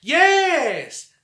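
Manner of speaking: usual speaking voice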